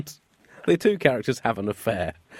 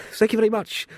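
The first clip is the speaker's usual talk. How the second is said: flutter it out, coy and flattered; blushing queen; 10 semitones higher than usual. Acted. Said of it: "blushed the ex-would-be cake distributress".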